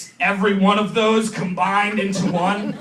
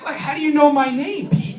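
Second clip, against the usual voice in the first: high, rising mock alarm; startled evaluator; 7 semitones higher than usual. Evaluator to cop who knows his name, high startled question. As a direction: question him, voice climbing high.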